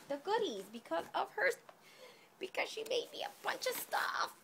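high, teary, choked-up voice; fighting back happy tears; tearing up. Real, not acted. Overwhelmed by a friend's gifts, teary and high. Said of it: "Bring on the waterworks".

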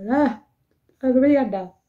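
put-on high, loud childlike crying plea; retold crying. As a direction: wail it like a little girl who can't stop crying. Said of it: "y yo empecé ahí a llorar".

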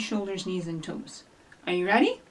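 usual speaking voice

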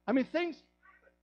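loud, high, fervent preaching; rising hellfire sermon voice; preaching at full force. Acted. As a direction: lift the room, full throated.

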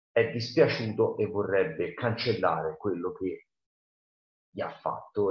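plain reading voice

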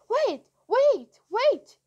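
high, loud urgent yell. A narrator's reading. Guy with flashlight cutting them off, high yell. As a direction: yell to stop them leaving. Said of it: "yelled the guy with the flashlight".

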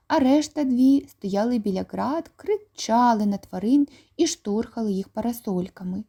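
usual speaking voice

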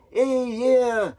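put-on cowboy accent. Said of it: "talking to him like he's a cowboy".

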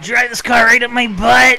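hoarse, high shouted Steve-O voice; Steve-O impression. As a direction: yell it like a wild stuntman. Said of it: "a Steve-O imitation".

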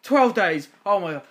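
dismayed outburst. Real, not acted. Player shocked the babies take twelve days. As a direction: gasp in disbelief.

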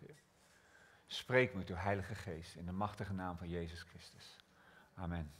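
intimate whispered-down prayer. Real, not acted. Prays before the sermon.

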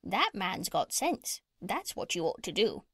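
squawky parrot voice with swooping pitch. Acted. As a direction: play a bossy parrot giving advice.

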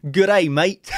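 loud, high hammed-up Aussie "Good day, mate"; jokey Aussie version of the intro; mock Australian greeting. Shown as a demonstration. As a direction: bellow it like a cartoon Australian. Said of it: "I should have started off this morning saying".

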